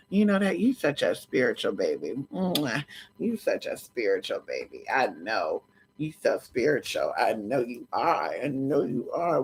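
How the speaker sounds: low, soft doting murmur; hushed, low sweet talk; tender pet talk. Real, not acted. Baby-talks tenderly to her pet.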